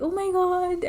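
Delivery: high-pitched imitated exclamation; excited friends. Imitating friends who came in after the proposal, excited. Friends hooting as they rush in.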